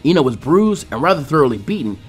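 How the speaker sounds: ordinary delivery, nothing marked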